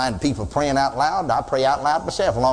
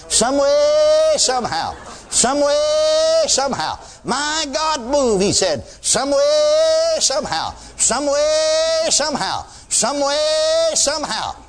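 imitating hollered prayer; 10 semitones higher, much slower than usual. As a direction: holler it like a desperate pastor. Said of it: "Tears in his voice".

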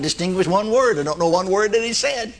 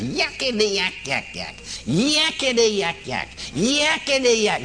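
high, shrill yakety yak like a whistle; shrill demon chatter; 4 semitones higher, slower than usual. Retold or imitated. Preacher mimics demon's shrill hollering. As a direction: squeal it like a tiny demon. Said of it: "Just shrill like a whistle almost".

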